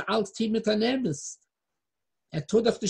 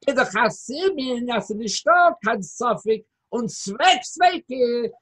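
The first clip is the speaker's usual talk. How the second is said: ordinary talk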